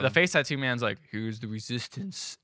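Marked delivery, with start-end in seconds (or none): 1.1-2.4 low, slow, much softer mock gravity; mock tough guy. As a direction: play it as a hammed-up ominous reveal. Voicing the face tattoo man.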